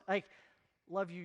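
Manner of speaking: ordinary talk, nothing marked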